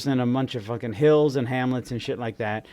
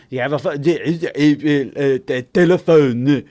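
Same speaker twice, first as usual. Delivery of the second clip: stammering, hammed-up fake French accent; English with French accent; 5 dB louder than usual. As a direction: grope loudly for words in mock French. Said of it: "speak English with a French accent".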